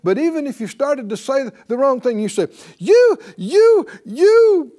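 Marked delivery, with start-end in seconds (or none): 2.8-4.7 high, loud outburst stuck on "you", then a drawn-out save; hammed-up fury turned into a forced blessing; mock anger, self-corrected. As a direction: start to curse, catch yourself and bless instead. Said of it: "catch yourself mid-sentence".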